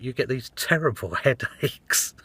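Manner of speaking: slowing and breaking into a laugh; punchline slowed with a laugh breaking through. Real, not acted. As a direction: deliver the punchline and crack up. Cracks up at punchline.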